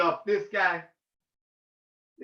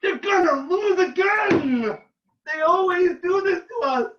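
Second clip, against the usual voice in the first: pessimistic fan yell; 9 semitones higher, 4 dB louder than usual. Retold or imitated. Friend's father to the room, shouting that they'll lose. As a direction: groan it out like a fed-up fan. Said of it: "my friend's father says".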